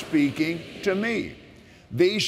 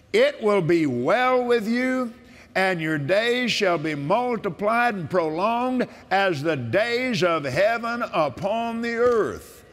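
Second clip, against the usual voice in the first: preaching with force; 5 semitones higher than usual. Acted. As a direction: declare it like a sermon. Proclaims scripture, higher.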